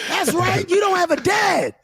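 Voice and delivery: high, jeering holler; mock heckler taunt. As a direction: rub it in with a high crowing voice. Acting out Philadelphia heckler's taunt.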